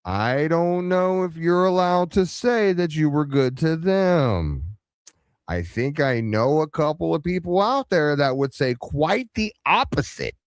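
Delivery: put-on high singsong pitch; high-pitched objection. Real, not acted. Mock objection to the other's claim.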